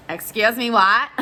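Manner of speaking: incredulous. Retold or imitated. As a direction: blurt it out in loud disbelief. Repeats it louder, stunned.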